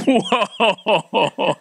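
high, comic whoa; mock amazement. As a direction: let out an exaggerated marvelling cry. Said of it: "I go like".

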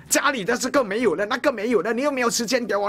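high nagging complaint; mock nagging wife. Preacher mimics a wife nagging her husband, high whine. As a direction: nag high and fast like a neglected wife. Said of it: "那么你是怎样的妻子呢".

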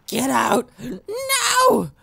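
high, hammed-up cry of protest; quarrelling parents. Divorcing parents in a story, mock quarrelling voices. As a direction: act out a mock domestic fight.

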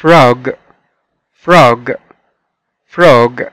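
frog said clearly; teacher models frog; model pronunciation. Shown as a demonstration. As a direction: frog carefully enunciated. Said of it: "مرة كمان".

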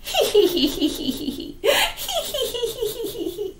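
high mischievous giggle; high impish laugh; sly fox giggle. Acted. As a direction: giggle like a trickster.

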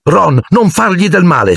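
high-pitched alarmed cry; shrieking. A narrator's reading. Hermione begging Ron to spare her cat, shrieking. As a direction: cry out high in protest without much volume. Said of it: "strillò Hermione".

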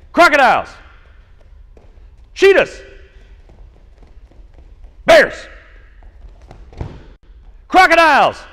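high, loud game call; called-out command. Real, not acted. Coach calling out jungle game cues to kids, loud high shout. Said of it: "I'm gonna call out".

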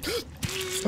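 soft, very high gasp; frightened gasp. Real, not acted. Player scared in the cave, frightened gasp. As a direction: catch the breath in fright.

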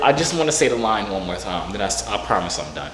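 ordinary talk, nothing marked

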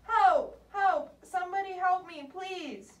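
put-on high-pitched child calling for help; frightened cry for help. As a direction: cry out in panic, fading on the plea.

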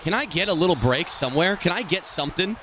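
wheedling, exasperated appeal; pleading. Real, not acted. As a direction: plead for a break, climbing to a high please. Begging the game for luck.

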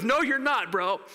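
high, urgent veto; acted firm refusal. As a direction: cut off a reckless friend. Friend restraining Paul in a chokehold.

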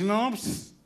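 put-on the pediatrician announces the baby is only hungry; pediatrician's verdict. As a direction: drag out the diagnosis like a pompous doctor. Pediatrician voice in joke.

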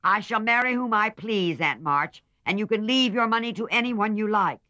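put-on a young woman standing up to her aunt; loud, high, defiant girl's voice; defiant Meg. A narrator's reading. Meg defies Aunt March.

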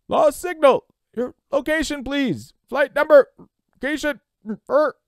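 high, choppy fragments of transmission; broken radio-operator voice; radio signal breaking up. Acted. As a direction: call out through a failing signal, words cut off. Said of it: "The voice was very faint and breaking up".

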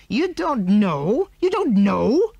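slow, loud disbelieving repeat; disgusted. A narrator's reading. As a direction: spit it out in disgust. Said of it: "said the man in disgust".

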